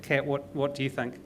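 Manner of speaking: usual speaking voice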